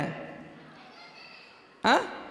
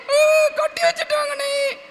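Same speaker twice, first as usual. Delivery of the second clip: slow high puppet wail; mock crying; 13 semitones higher, slower than usual. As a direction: whine it out as a badly faked sob. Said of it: "அப்படின்னு அழுதுட்டு இருந்துச்சா?". (பொய் அழுகையாக இழுத்த கீச்சுக் குரல்)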